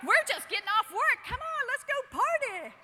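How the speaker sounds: loud, high, excited coaxing; party invitation. Retold or imitated. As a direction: call out like an eager crew. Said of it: "They're like".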